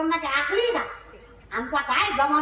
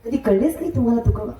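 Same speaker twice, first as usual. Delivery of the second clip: loud, lower impassioned kirtan preaching; preaching at full force; 7 semitones lower, 4 dB louder than usual. Acted. Preacher rousing the village crowd about saints, loud and fervent. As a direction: build the sermon into a ringing loud climax.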